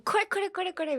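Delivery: high, rapid-fire repeated これ; excited high stress on each これ; emphatic これ. Shown as a demonstration. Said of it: "これ強調したい時に".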